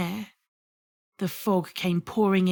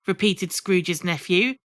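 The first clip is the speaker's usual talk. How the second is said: ordinary delivery, nothing marked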